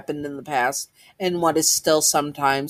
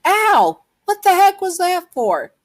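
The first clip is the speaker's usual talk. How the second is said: very high, loud acted yell; shrill protest after being hit; pained yell; 6 dB louder than usual. A narrator's reading. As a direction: yelp it out in hurt outrage. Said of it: "and he yelled".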